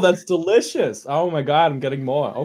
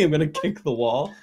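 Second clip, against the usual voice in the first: ordinary talk, nothing marked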